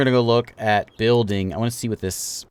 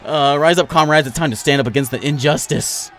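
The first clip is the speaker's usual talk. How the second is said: fiery revolutionary speech; 5 semitones higher, 7 dB louder than usual. Acted. Rallying rioters in game.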